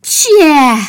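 loud, high acted sneeze. A narrator's reading. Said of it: "عطسه کرد و گفت". (عطسهٔ بلند نمایشی)

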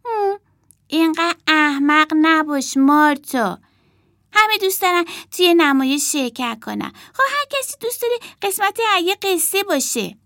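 high, scolding then rushing on; bossy big sister. Acted. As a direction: dismiss her worry briskly. Emma scolding Marta.

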